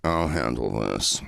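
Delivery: Will stepping in, low and slow; calm take-charge. Retold or imitated. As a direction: drop the voice low and slow like a man in control. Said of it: "Will goes".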